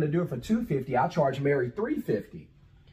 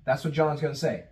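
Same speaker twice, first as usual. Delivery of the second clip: ordinary delivery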